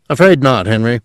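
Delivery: ordinary delivery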